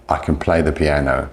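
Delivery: weak "can", reduced to kn before "play"; unstressed can. Shown as a demonstration. Teacher showing the positive, unstressed "can". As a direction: slip lightly over "can", stress "play" and "piano". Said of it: "the can is not stressed".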